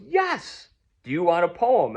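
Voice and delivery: high, loud retold enthusiasm; eager reply. Radio poet offering a poem to a fan, eager.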